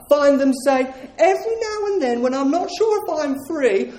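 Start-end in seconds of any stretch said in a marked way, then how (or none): 1.2-3.9 mocking quote. Mock quote of released prisoners.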